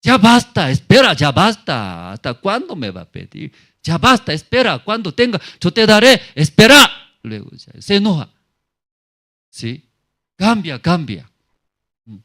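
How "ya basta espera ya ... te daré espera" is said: high, loud fed-up shout; exasperated anger. Acted. Borrower snapping at brother who keeps asking, loud high angry. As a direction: cut them off, done with asking. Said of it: "qué dice?".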